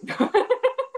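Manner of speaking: high, bursting laugh. Real, not acted. Laughing at the midwife story, high laugh.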